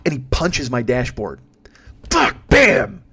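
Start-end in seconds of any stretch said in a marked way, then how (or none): 2.1-3.0 loud high swear then bam; swear and bang. Retold or imitated. Comedian acting out friend punching the dashboard, loud swear and bam. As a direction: act out the punch.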